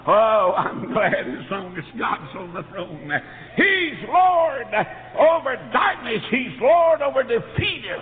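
high, loud, slow proclamation; preaching at full force. Acted. Preacher proclaiming God's lordship to the flock, loud and high. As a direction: ring it out like a revival climax.

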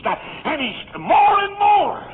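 very slow, high, loud cry; preaching shout. Real, not acted. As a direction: drag each word out at full volume. Preaching climax.

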